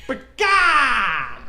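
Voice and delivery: bird screech. Pretend. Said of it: "sounded feminine, but it's scary that way".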